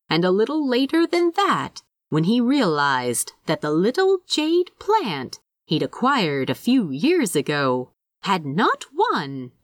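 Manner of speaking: usual speaking voice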